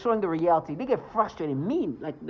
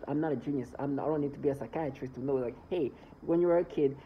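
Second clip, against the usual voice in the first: ordinary talk, nothing marked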